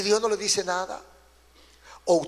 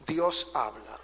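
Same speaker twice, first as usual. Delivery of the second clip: low, hushed pronouncement; solemn refrain; 9 semitones lower, 7 dB quieter, slower than usual. Real, not acted. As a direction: drop right down and state it softly. Solemn refrain answering each loud clause.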